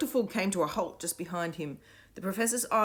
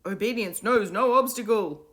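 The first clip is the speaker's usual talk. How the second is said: droning repetition; 6 semitones higher, 8 dB louder than usual. A narrator's reading. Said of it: "repeated the kids".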